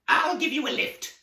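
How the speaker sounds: very high, loud cackly crone; witch voice. A narrator's reading. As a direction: squeal it like an old witch. Baba Yaga speaks.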